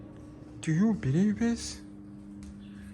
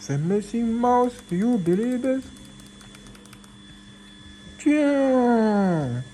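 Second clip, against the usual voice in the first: excited showing off; 7 semitones higher, 4 dB louder than usual. Real, not acted. Excited about the mouse.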